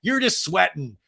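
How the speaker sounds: high, stammering mock backtrack; backpedaling. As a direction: imitate a husband scrambling to undo his joke. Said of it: "realizes he's gone too far".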